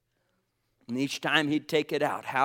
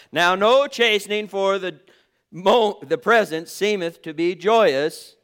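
emphatic scripture reading; 5 semitones higher, 9 dB louder than usual. Real, not acted. Preacher repeating the verse for congregation's response, emphatic and loud.

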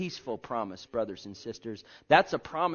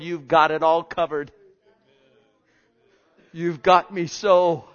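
loud, rising preacher's cry; full-force preaching; 2 semitones higher, 10 dB louder than usual. Acted. Preacher to his congregation, loud fervent proclaiming.

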